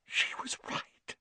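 breathless, voiceless repeat; hushed, anguished whisper; tragic. A narrator's reading. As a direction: let it fade to a grief-stricken whisper. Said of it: "said Lavender tragically".

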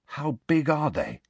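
ordinary delivery

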